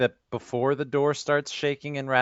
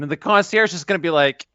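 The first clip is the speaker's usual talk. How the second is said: usual speaking voice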